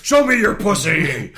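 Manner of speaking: slow gravelly vigilante growl; Batman growl. Pretend. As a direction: snarl it like a gravel-throated Batman. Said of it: "Pete Holmes doing the Batman".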